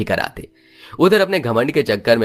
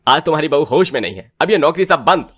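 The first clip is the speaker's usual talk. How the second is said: angry husband; 6 semitones higher than usual. Acted. Husband scolds drunk wife.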